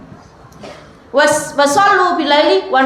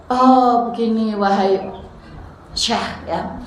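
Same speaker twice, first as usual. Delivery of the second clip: weeping man's reply; 4 semitones lower, 4 dB quieter, slower than usual. Acted. Weeping man answers the sheikh.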